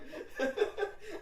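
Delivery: high, breath-driven burst of laughing; real laughter. Real, not acted. Telling how she panicked for nothing, bursting into laughter. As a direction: crack up at your own story.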